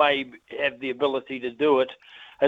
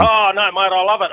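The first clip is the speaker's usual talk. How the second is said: gleeful gloat; 4 semitones higher, 6 dB louder than usual. Real, not acted. Caller gloats over Aussie win.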